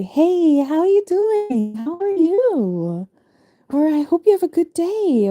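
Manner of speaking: high, sugary fake greeting; the enemy's fake cheerful hello; fake friendly. Mimicking fake-friendly enemies.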